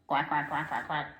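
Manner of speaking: rapid string of quacks; quick-fire duck chatter; duck quacking. A narrator's reading. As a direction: rattle off quick short quacks. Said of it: "but out came a quack".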